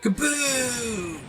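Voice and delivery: high, loud, held "boom" that drops at the end; high-pitched sound-effect shout; mock explosion cry. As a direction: belt out a drawn-out cartoon kaboom. Mimicking game's explosion cry.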